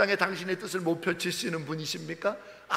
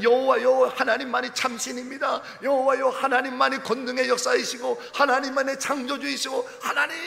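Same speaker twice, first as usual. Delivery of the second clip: loud, raised proclamation of faith; fervent crying out; 5 semitones higher, 4 dB louder than usual. Acted. Preacher voicing Elijah proclaiming God, loud and fervent. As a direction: shout out the name 여호와 in zeal.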